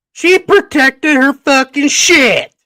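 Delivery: loud, high, drawn-out outburst; emphatic shouting. Real, not acted. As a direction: belt out every word slowly at high pitch. Emphatic outburst telling the aftermath.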